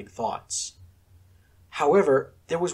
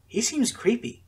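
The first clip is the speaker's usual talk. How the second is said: put-on girl voice; 10 semitones higher than usual. A narrator's reading. Asuna voiced in story.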